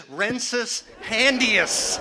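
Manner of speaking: slow, drawn-out mock Latin incantation; mock wizard spell. At the bathroom sink, mock wizard casting a spell. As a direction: cast it like a Hogwarts spell. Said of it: "doing magical passes".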